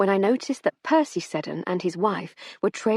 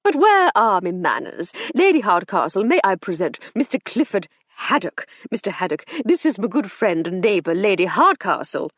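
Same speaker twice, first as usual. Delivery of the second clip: put-on jovial old gent; 3 semitones higher, 6 dB louder than usual. A narrator's reading. Sir Hector introduces guest.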